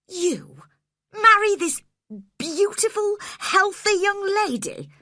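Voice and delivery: high, loud outraged cry; indignant cry. A narrator's reading. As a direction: cry out in disbelief. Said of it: "I cried".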